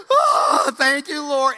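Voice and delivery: high, loud cry of thanks; hammed-up grateful cry; loud relief. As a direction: cry it out as if just saved. Relieved after near drowning.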